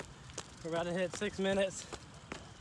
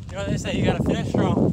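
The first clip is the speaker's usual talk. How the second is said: loud; 10 dB louder than usual. Real, not acted. Sprinting the last stretch.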